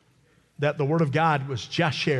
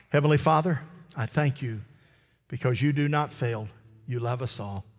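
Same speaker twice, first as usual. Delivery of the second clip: prayer. Acted. Leads a prayer.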